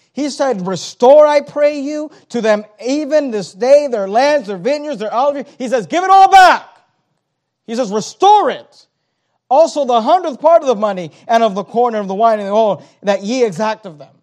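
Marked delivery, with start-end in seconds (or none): 0.7-5.9 loud, forceful rebuke with a shouted peak; angry rebuke. Acted. Preacher voicing Nehemiah's rebuke again. 5.9-6.7 high, loud shout; shouted demand. Acted. Paraphrasing the rebuke at full force. 7.6-14.1 loud, forceful rebuke with a shouted peak; angry rebuke. Acted. Preacher voicing Nehemiah's rebuke again.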